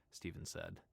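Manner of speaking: ordinary delivery, nothing marked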